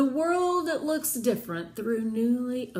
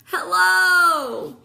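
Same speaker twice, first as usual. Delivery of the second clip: a loud, high call upward; 7 semitones higher, 10 dB louder than usual. A narrator's reading. Virgil calling up out of the well, loud. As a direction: shout it up the well. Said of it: "he called".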